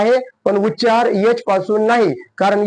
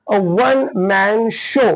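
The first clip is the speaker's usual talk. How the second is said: 1 slow model phrase; much slower than usual. Shown as a demonstration. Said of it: "ह्याचा उच्चार कसा आहे".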